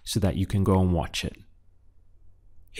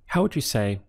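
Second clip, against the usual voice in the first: ordinary talk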